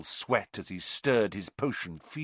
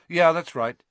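higher, louder throwaway answer; offhand reply; 9 semitones higher, 7 dB louder than usual. A narrator's reading. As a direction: toss it off casually.

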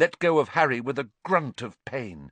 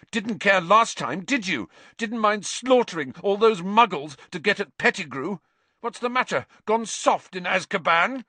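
angry taunting; 9 semitones higher, 4 dB louder than usual. A narrator's reading. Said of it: "Harry spat".

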